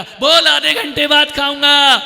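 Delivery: loud, high-pitched retort; angry shout. Acted. Father sending word back through his son, shouting angrily. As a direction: holler back irritably.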